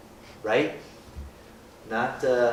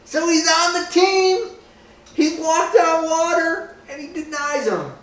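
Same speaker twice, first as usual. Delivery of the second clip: much higher, louder preaching; preaching at full force; 7 dB louder than usual. Acted. Preacher telling the group Peter denied Jesus, much higher and louder. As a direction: drive home the denial.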